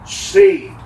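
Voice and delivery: "Saved" called out high and loud; headline cry. Retold or imitated. Preacher voicing a rescue headline, proclaiming. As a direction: announce it like a headline. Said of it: "again the headline will say".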